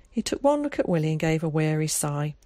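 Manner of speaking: ordinary delivery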